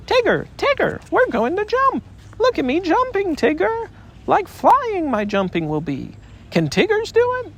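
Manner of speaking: very high, squeaky and thrilled; wildly excited Roo. A narrator's reading. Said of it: "he was wildly excited".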